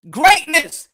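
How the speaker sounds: "Greatness" punched loud and high; stressed greatness. Real, not acted. Defending his title to YouTubers, punching "Greatness" loud. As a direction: slam the word "Greatness". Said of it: "What does it say about [name]'s greatness?".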